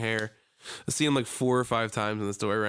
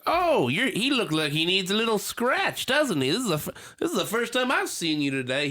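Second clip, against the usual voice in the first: old man impression; 8 semitones higher than usual. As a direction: sweet-talk the dog in a high old-man voice. Imitating the old man again.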